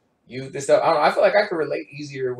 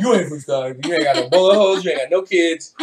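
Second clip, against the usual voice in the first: louder, rising mock roast; mock tough-talk; 3 semitones higher, 8 dB louder than usual. Roasting a too-pretty girl for not being local, loud mock taunt. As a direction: rattle off the Stockton checklist like a taunt. Mocking a Stockton girl's checklist.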